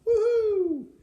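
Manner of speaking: very high, very loud whoop of joy; gleeful whoop. Acted. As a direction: whoop like you're racing home free. Beast escapes to the woods.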